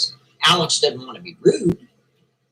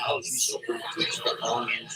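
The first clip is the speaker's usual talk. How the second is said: softer, whispered admiring aside; 9 semitones lower, 12 dB quieter than usual. Real, not acted. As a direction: drop to a whisper as if sharing a secret. Whispered aside about the girlfriend.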